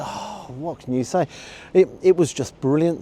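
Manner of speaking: usual speaking voice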